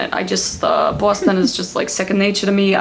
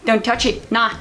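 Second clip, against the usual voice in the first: over-the-top Aussie accent, pitched up; broad Australian accent; 9 semitones higher than usual. Shown as a demonstration. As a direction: exclaim in thick broad Australian. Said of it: "And then we go broad Australian".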